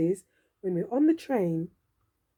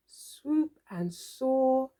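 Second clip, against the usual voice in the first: slow; much slower than usual. Acted. Slow flying description.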